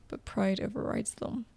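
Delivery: usual speaking voice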